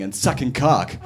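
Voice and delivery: ordinary talk, nothing marked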